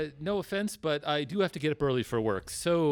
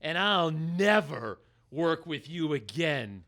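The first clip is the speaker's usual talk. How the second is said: normal talking voice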